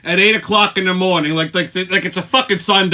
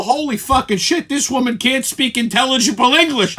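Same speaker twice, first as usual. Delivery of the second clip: usual speaking voice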